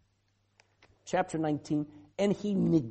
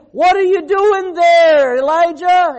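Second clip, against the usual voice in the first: high, loud and slow, an angry rebuke; shouted accusation from the sky; mock booming God; 9 semitones higher, 15 dB louder than usual. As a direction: thunder it down at him like a scolding God. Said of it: "God's not saying".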